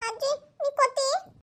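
put-on high, slow little kid voice; drawn-out childish plea; pleading small child. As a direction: wheedle grandma like a toddler.

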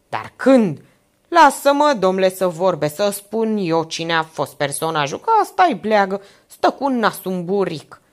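loud, heated protest; angry retort. Acted. Old man brushes off the major, angrily protesting.

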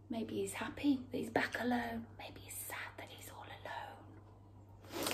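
hushed, gentle musing; soft whisper. Acted. Reader wondering how the bear feels, whispering. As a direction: whisper it thoughtfully.

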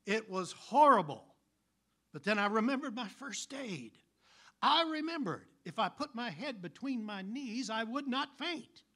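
a shocked lady reliving it; woman telling. Retold or imitated. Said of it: "She said".

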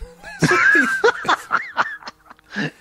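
loud squealing laugh; high burst of laughter. Real, not acted. Co-host bursts out squealing laughter at the line. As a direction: shriek with laughter. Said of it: "big laugh".

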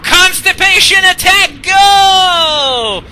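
high, drawn-out comic attack shout; mock battle cry. Player mocking the boss's attack, hammed-up battle cry. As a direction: bellow it like a hammed-up special-move call.

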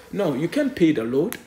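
normal talking voice

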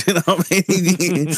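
laughing through the words. Real, not acted. Soldier laughing to the interviewer about the Scouse fella. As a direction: chuckle as you tell it.

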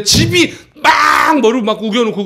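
long, high-pitched 막, drawn out with force; emphatic 막. Shown as a demonstration. Teacher acting out why a house cannot cram things, emphatic stretched 막. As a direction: stretch 막 out high, as if cramming hard.

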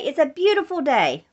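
put-on prompting a toddler to repeat; coaxing prompt. Shown as a demonstration. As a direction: say it clearly for baby to copy.

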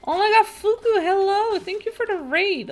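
high, loud, thrilled welcome; excited greeting. Real, not acted. Streamer welcoming Fuku's raid, high loud excited greeting. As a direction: burst out delighted at friends arriving.

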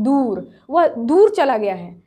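दूर with the vowel stretched long; long oo in door. Shown as a demonstration. Teacher stretching दूर so students hear the long vowel. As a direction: pull out the oo of दूर slowly. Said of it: "थोड़ा दूर को खींच कर पढ़ते हैं".

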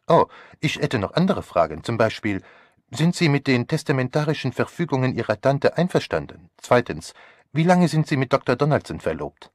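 put-on high, insistent French-accented questioning; brisk detective listing his questions; Poirot. A narrator's reading. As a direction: press on politely and pointedly. Plays Poirot.

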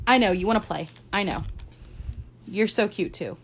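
normal talking voice

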